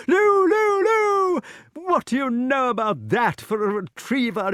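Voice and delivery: very high hallooing, easing at the end; high-pitched huntsman's cries; excited king. A narrator's reading. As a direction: whoop the hound on, then marvel proudly. King still hallooing his beast.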